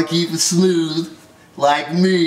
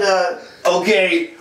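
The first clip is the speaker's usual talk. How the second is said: normal talking voice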